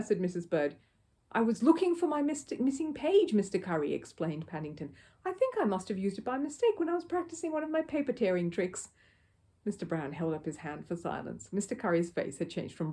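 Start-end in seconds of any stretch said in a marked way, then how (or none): 1.3-8.9 lightly higher voice of an earnest little bear; young bear. A narrator's reading. Little bear explaining to the neighbour, lightly voiced. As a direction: explain politely like a small bear. Paddington's voice.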